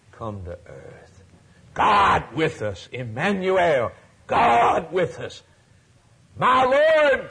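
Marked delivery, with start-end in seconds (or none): 1.7-5.4 slow ringing shout. Acted. Preacher proclaiming God with us to the congregation, shouting. 6.4-7.3 high, loud exclamation; Thomas's cry. Acted. Preacher voicing Thomas crying to Jesus, high and loud.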